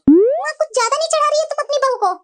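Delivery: petulant daughter airing grievances; complaining daughter. Acted. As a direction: sulk and complain to mom. Daughter resents mother.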